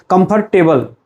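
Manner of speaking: "comfortable" split, with a clear tay-bul; wrong comfortable. Shown as a demonstration. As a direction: say "comfortable" ending in the full word table. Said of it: "don't pronounce it".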